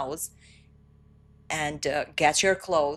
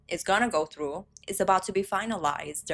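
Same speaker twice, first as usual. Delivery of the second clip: usual speaking voice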